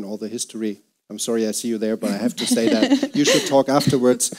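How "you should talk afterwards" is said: laughing loudly while teasing. Real, not acted. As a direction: say it through a laugh, louder.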